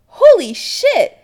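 loud, high, drawn-out shocked cry; exclaiming. Acted. Adam to Chris after the vanishing, loud exclamation. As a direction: stretch it out in loud amazement. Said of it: "Adam exclaims".

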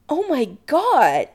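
high, loud, drawn-out amazement; exclaiming. Acted. Adam learning of the potion, amazed exclamation. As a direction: stretch it out, thrilled and stunned. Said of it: "he exclaims".

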